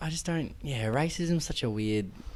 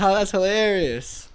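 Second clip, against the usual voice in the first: mock amused; 8 semitones higher than usual. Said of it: "So people will go".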